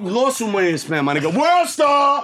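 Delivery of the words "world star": high-pitched hype holler; shouted catchphrase. Pretend. Comic hollering to the room after a boast, excited shout. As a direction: call it out like a hype man.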